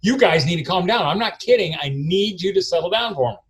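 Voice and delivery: put-on a dad losing patience with rowdy kids; stern dad warning. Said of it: "and I'm like".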